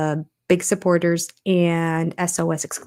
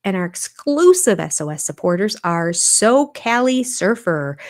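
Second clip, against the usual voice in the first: ordinary delivery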